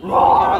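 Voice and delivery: put-on loud playful roar for the kids; lion roar. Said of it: "this is a real lion".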